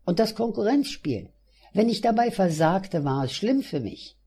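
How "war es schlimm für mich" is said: plain reading voice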